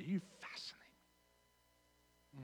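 barely voiced, hushed compliment; mock whisper. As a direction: whisper it to your spouse. Models remark to a spouse.

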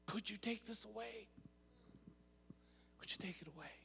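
quiet, broken prayer to Daddy; whisper-soft begging, fading away; a tender, hushed plea; soft intimate plea. Retold or imitated. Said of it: "he says".

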